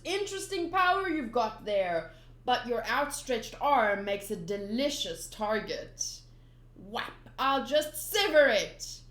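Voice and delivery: slow, loud threatening gloat; menacing villain. Acted. Buggy threatening Luffy's arm, slow and loud. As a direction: savour the threat. Reading manga fight.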